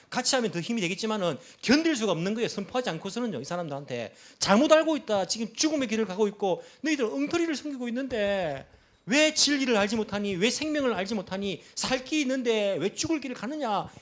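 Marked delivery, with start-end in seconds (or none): 4.4-13.9 high-pitched anguished rebuke; impassioned indignation. Acted. Preacher to idol worshippers who follow the wrong path, indignant pleading. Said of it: "이 분노 있지 않습니까".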